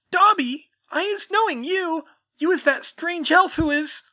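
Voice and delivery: very high, loud trembling elf squeak; timid house-elf greeting a stranger, quivering squeak. Acted. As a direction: squeak it out, small and nervous. Winky recognises Dobby.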